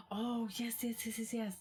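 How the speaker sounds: high excited yes-yes-yes; eager rapid agreement. Real, not acted. Excited recognition of a friend.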